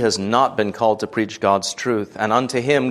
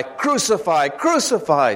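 high-pitched narrated cry, no real shout; crowd's cry; 7 semitones higher than usual. A narrator's reading. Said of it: "they will cry".

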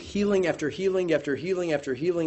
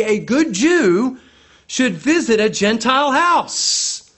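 slow, higher, deliberate emphasis; emphatic stress; 6 semitones higher, 6 dB louder, slower than usual. Shown as a demonstration. Preacher reading the commentator with heavy emphasis. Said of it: "the emphatic I draws attention".

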